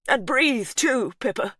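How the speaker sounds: high, loud and slow; husband calling to labouring wife, high and loud; urgent cry. A narrator's reading. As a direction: call it out to her. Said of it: "Hugh cried".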